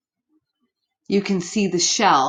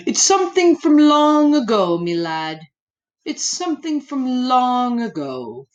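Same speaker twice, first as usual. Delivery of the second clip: put-on old storyteller; 3 semitones higher, 4 dB louder than usual. Storyteller answering the boy, old man's voice. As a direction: spin it like an old teller of scary stories.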